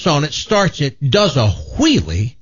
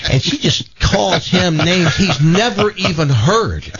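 ordinary talk

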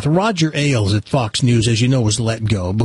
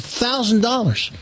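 put-on light kid voice; 8 semitones higher than usual. Kid boasting in the joke.

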